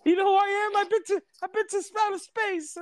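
very high squeaky cartoon boast; high-pitched cartoon bluster; Mario talk. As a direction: brag and threaten as a squeaky little plumber. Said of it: "you gotta do the Mario talk".

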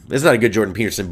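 ordinary talk

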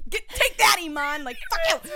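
very high, loud mock victory yell; high laughing triumph shout; gloating shout. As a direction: crow over your rival. Gloating at a friend.

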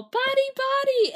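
high, loud game call; ringing demonstration shout; yell. Retold or imitated. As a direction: yell it out high, imitating players raising the alarm. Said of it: "then they yell".

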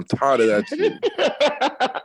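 high, slow, laughing it out. Real, not acted. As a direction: stretch the line out while cracking up. Mocking teammate, breaks laughing.

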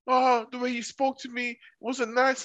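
high, drawn-out mock hurt; mock wounded complaint. Imitating a housemate complaining to her partner, mock wounded. Retold quarrel, wronged woman complains.

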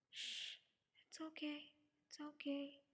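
soft, hushed comforting; soothing whisper. A narrator's reading. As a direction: hush and reassure gently. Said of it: "He kept whispering things to me".

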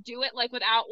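ordinary talk

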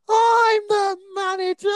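high, slow, pompous coach asserting authority; mock coach putdown. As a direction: parody the coach pulling rank on the player. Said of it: "Barry turns around to me and just goes".

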